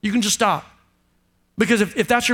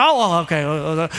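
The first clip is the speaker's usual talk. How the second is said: low, slow sheepish "oh, well, okay"; sheepish backdown; 8 semitones lower than usual. Retold or imitated. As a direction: back down, embarrassed. Acted quarrel, backing down.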